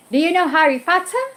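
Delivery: high-pitched mock British accent; British imitation with dropped R's. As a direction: ask it in a playful British accent. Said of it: "teach me the British accent".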